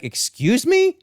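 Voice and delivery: very high, indignant question; hammed-up indignant disbelief; affronted. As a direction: shoot the pitch up in mock outrage. Aunt's affronted reply acted out.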